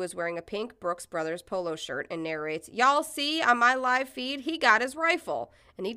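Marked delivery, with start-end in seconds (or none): 2.7-5.5 louder, higher livestream call-out; live narration. Retold or imitated. Protester narrating the live feed, calling out.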